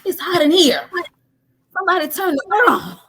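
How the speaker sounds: high, loud cry of someone overheating; hammed-up overheated shouting; mock screaming. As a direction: yell it out, high-pitched, acting too hot. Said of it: "I know she was screaming".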